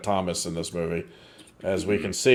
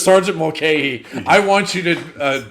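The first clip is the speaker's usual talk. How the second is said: higher, louder mock command; officer giving orders; 4 semitones higher, 6 dB louder than usual. Host as Broderick's character ordering the sergeant to act, mock commanding tone. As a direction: imitate an officer issuing a firm order.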